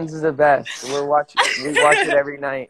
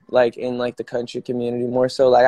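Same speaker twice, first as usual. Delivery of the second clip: ordinary talk, nothing marked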